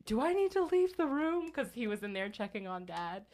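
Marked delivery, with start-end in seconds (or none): 0.1-1.5 lightly voiced nurse, higher; a hint of a polite nurse; nurse quoting. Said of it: "the nurse was like".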